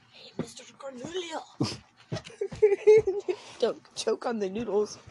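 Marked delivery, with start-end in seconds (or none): 0.1-1.4 playful whisper. Pretend. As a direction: breathe it out in a whisper. Unvoiced teasing whisper.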